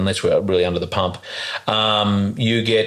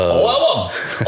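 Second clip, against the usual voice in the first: slow, higher Scottish "Eleven", laughing; mock Scottish accent; 7 semitones higher, much slower than usual. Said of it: "two Scottish guys in the elevator".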